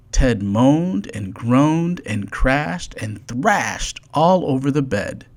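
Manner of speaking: ordinary delivery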